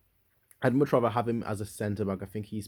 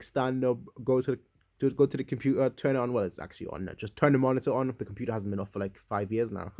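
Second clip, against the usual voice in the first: normal talking voice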